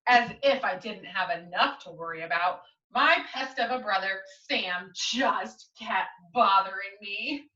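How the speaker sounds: lively picture-book reading; story narration. Acted. Teacher reading the class a storybook, lively and clear. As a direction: read it aloud to young listeners.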